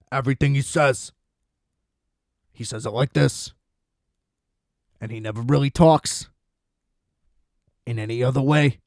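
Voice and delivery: low voice in halting phrases with long pauses. Acted. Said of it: "doing a bad riza impersonation".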